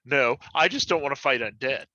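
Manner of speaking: ordinary talk, nothing marked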